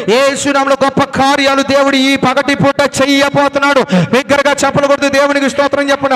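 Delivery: loud, fervent preacher's cry to the congregation; full-force preaching. Acted. Pastor exhorting the congregation, in loud full-force preaching. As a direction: thunder the call to repent over the crowd. Preacher declaring God's call.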